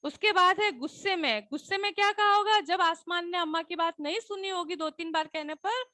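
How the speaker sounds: ordinary talk, nothing marked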